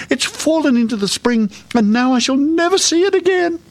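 high-pitched tearful wail; crying. A narrator's reading. Princess despairs of seeing her ball again, crying. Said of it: "she cried".

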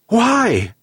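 high-pitched anguished why; desperate plea. A narrator's reading. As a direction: cry it out in despair toward the sea. Desperate old man on his knees.

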